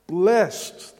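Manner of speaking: Blessed said loud and falling; Blessed, proclaimed with weight; emphatic blessed. Shown as a demonstration. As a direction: land hard on Blessed. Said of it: "And that word means".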